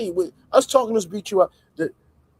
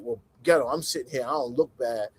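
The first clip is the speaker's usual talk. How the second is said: mocking Envy; 5 semitones lower, 5 dB quieter than usual. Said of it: "you was like".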